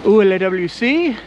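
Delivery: loud, high English-accented French; foreign accent in French. Shown as a demonstration. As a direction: tourist French, said carefully. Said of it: "I can ask where the bathroom is".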